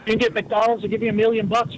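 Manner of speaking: ordinary talk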